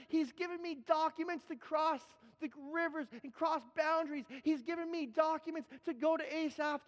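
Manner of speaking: high, ringing preacher's build to the congregation; full-force preaching. Acted. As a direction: preach it out, lifting them up. Pastor rallying the congregation.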